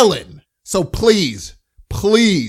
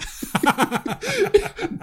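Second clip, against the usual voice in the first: high-pitched laughter; 5 dB quieter than usual. Real, not acted. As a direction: burst into high giggling. Laughing at the email.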